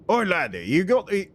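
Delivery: wobbly Scottish sliding toward Cockney; Scottish accent. Shown as a demonstration. Streamer trying Scottish after Russian, Scottish accent. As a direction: attempt a Scottish lilt and let it slip. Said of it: "then you do that in Scotland".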